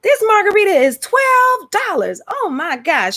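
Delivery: high-pitched mock outrage at a price; imitating shocked YouTuber. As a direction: exclaim like a scandalized young YouTuber. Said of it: "talking about how expensive it was".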